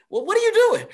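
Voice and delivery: high, loud mock scolding; incredulous call-out. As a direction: call her out in disbelief.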